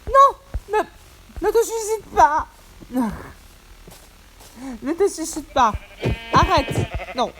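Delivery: high shrill pleading yells; panicked yell. Real, not acted. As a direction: shout at it to stop. Yelling at animal jumping.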